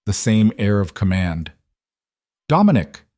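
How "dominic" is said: a lightly voiced, refined older matriarch calling out, a bit higher and louder; cultured mother. A narrator's reading. Isabella greeting her late son, a lightly voiced cultured call. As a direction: call his name with poised authority. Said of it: "Her voice was cultured, refined".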